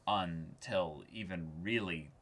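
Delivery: usual speaking voice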